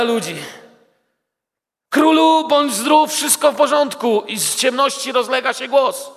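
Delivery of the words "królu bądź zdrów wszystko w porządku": high, loud reassuring call; cheerful greeting. Acted. Daniel calls from the den to the worried king, loud and high. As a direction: shout up that all is well.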